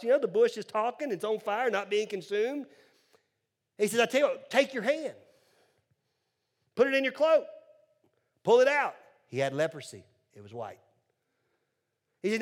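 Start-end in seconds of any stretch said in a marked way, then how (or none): 4.0-9.0 high, loud order; commanding God. Acted. Preacher voices God telling Moses what to do, high and commanding. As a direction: issue instructions as God, pitched up and forceful.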